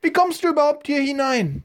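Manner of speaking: put-on very high falsetto old lady, calling out; indignant woman's voice. A narrator's reading. Old woman catches boy in her garden, indignant high falsetto call. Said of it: "rief eine Frauenstimme hinter ihm".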